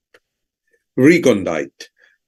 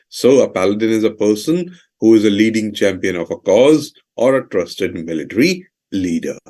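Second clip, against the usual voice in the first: ordinary talk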